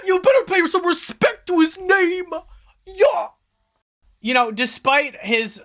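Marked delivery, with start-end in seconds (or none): 0.0-3.3 much higher, put-on tough guy voice; menacing henchman voice. Henchman warns the party to respect the boss's name. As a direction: warn them off in a pitched-up thug voice. GM voices a character, far timbre.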